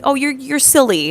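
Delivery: high, slow, drawn-out silly tease; mock mild scolding. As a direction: put on a harmless, sugary telling-off. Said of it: "I don't wanna be like".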